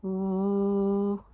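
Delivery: "ooh" held long on one steady note; long, level "ooh"; stretched-out sound. Shown as a demonstration. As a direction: sustain "ooh" evenly as a phonics sound. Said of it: "This sound says".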